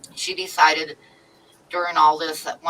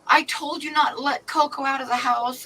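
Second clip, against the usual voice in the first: retold anger; 10 semitones higher than usual. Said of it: "she's like".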